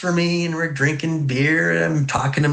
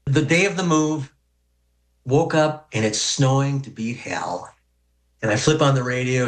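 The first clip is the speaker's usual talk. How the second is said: normal talking voice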